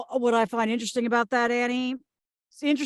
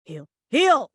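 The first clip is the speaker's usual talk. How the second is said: calling dogs; 5 dB louder than usual. Acted. [name] commanding unruly dogs.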